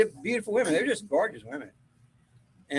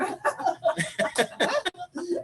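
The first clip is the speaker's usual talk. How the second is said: long high laugh; amused laughing fit; 13 semitones higher than usual. Real, not acted. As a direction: dissolve into laughter. Laughing about the crying.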